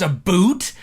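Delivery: "a boot" for "about"; mock Canadian "about". Shown as a demonstration. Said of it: "Nobody says".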